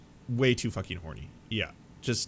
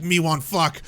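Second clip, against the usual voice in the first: loud, higher, blunt caveman line; mock caveman come-on; 7 semitones higher, 7 dB louder than usual. A creep opening a DM, mock caveman come-on. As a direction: grunt it like a horny caveman.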